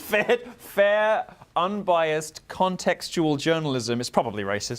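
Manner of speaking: high, drawn-out ironic fair; deadpan mock praise; sarcastic. Mocking reply to sarcastic joke.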